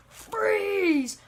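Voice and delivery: high, drawn-out mock shout; shouted command. Rotten Apple busts in with a gun, high commanding yell. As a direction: burst in and halt everyone. Gunman bursts through the door.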